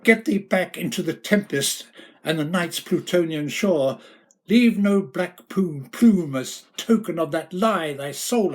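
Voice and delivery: high, loud, furious orders; enraged shouted banishment, easing at the end; shrieking in rage. Acted. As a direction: drive the bird out with your voice. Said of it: "I shrieked".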